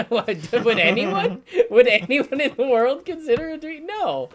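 high, loud rhetorical protest; loud, high disbelief with a drawn-out no; incredulous outburst. Real, not acted. Podcaster scoffing at co-host's food pick, incredulous.

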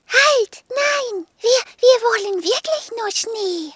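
put-on a little girl butting in to stop it; girl interrupts the machine insisting on snow; urgent little girl. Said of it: "mischte sich auch das kleine Mädchen ein".

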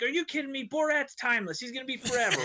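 mock indignant defense. Groom defends his joke to wife.

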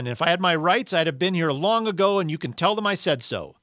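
loud, high-pitched, argumentative outburst; belligerent, aggrieved character voice; indignant ghost. Acted. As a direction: argue back, insisting on your rights. Ghost's protest continues.

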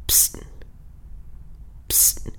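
a hushed psst to get attention; stage whisper. Acted. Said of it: "and he's like".

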